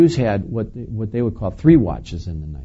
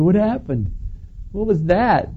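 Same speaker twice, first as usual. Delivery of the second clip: startled confusion; 10 semitones higher than usual. Retold or imitated. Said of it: "You go".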